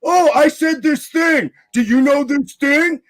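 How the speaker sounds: mimicking the opponent's bragging, high and drawn out; mocking impression. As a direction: parrot him like a smug braggart. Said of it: "You're like".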